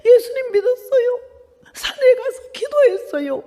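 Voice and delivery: high voice breaking into crying, slowing; telling the nun through tears; tearful confession. Retold or imitated. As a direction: sob it out between laughs.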